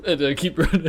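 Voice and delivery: laughing. Real, not acted. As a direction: giggle through the words. Player laughs about eating cheese.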